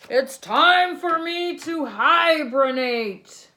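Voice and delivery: slow, loud, high bear proclamation; big bear declaration. Acted. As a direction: announce it like a proud bear. Bear announces hibernation.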